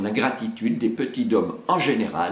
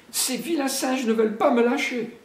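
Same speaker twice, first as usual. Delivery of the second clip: put-on higher child voice, complaining; a boy stuck upside down, higher; Mowgli's voice. Mowgli answers the bear from upside down, higher voice. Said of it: "répondit Mowgli".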